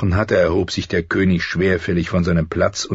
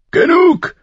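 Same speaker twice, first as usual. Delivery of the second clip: one loud, high bellowed word; thundering; 8 dB louder than usual. Acted. The king silences the quarrelling children with a loud roar. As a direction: cut them all off at full voice. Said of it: "donnerte der König".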